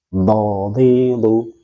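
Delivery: "Do, di, du" each voiced without blockage; smooth-flowing dhad. Shown as a demonstration. Teacher drilling the letter with three vowels, flowing and unblocked. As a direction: let "Do, di, du" flow, never held back. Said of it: "Bukan tertahan".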